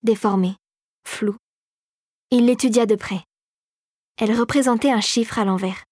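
ordinary delivery, nothing marked

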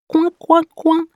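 high quacking coin coin; high duckling quacks; duck quacking. A narrator's reading. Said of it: "se transforme en petit canard".

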